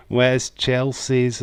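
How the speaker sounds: ordinary talk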